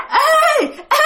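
high, piercing mocking call; shrill demon-pig cackle. Demon pig cries after the fleeing man, shrill high call. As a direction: screech it high like a laughing ghost. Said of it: "tiếng nó cười the thé".

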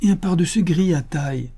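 high, emphatic woman's voice; insistent repetition. A narrator's reading. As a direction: add the detail insistently. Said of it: "répéta madame Renaud".

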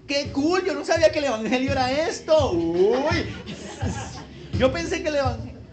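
mock churchgoer. Preacher mocks newcomers at a disco-like church, gushing. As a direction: ham up a dazzled trendy churchgoer. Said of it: "la gente entra allá y dice".

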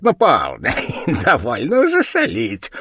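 squeaky devil chuckling through the words; giggling demon, rising high; laughing devil. A narrator's reading. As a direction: laugh it off loudly. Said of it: "закричал, смеясь, чёрт".

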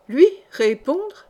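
high, loud, slow outraged question; scornful cry. A narrator's reading. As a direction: fling the words out in disbelief. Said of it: "s'écria-t-il".

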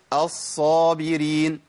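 الصابرين with a long, heavy doubled ص; doubled saad. Shown as a demonstration. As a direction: lean on the shadda in الصابرين, slowly. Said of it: "الصاد مشددة اهي".

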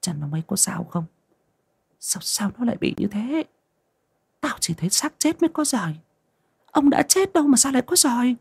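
put-on high, thin alarmed old woman; shrill with fear over her husband; worried old mother. A narrator's reading. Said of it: "Bà nói bằng tiếng dân tộc".

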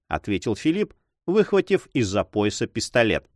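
ordinary delivery

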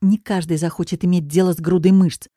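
ordinary delivery

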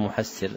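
محسر as muhassir, with shadda; doubled form. Shown as a demonstration. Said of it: "والذي نذكره سمعناه".

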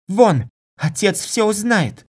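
loud, high-pitched outburst; furious insult. Acted. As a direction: hurl it at him in outrage. Girl throws him out.

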